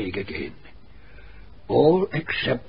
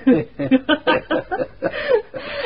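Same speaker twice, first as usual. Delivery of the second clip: laugh; 4 dB louder than usual. Acted. Laugh after naming the visitors.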